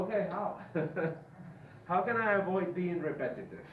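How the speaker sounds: ordinary talk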